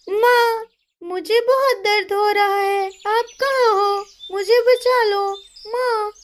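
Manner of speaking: put-on high pleading girl's cry for help; crying little girl. Hurt little bird calling her mother, high tearful voice. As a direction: call for mum in pain.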